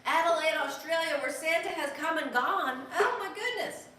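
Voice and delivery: high, loud and laughing; excited. Real, not acted. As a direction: burst out with laughing delight. Excited reading of greeting.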